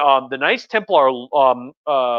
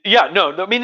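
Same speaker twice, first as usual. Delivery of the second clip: ordinary delivery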